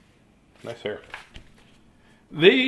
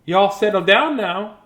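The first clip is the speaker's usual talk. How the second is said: high, loud, slow drawled order; sweetly bossy puppet voice; Piggy's voice; 7 semitones higher, 5 dB louder, slower than usual. Acted. Giving Miss Nelson a puppet's voice, hammed up. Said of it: "let's give her Piggy's voice".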